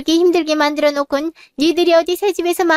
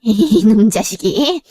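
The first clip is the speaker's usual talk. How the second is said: slow, low, seething growl; mother erupting at her insolent son, slow and low; angry growl; 5 semitones lower, much slower than usual. Acted. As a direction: drag each word out through clenched fury. Mother explodes at younger son.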